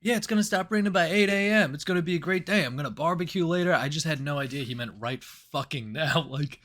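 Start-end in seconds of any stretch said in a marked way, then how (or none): 0.0-3.7 mock cheerful manager. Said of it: "I just thought he was like".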